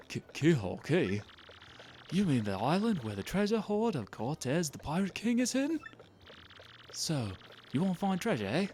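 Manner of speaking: lightly voiced pirate-town local; game character giving an eager, spooky warning; warning townsperson.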